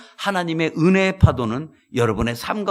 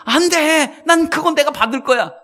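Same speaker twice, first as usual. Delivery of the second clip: high, louder greedy cry; greedy shout; 10 semitones higher, 4 dB louder than usual. Acted. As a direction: claim it for yourself loudly. Retold man claims reward himself. (욕심내며 높게 외치는 소리)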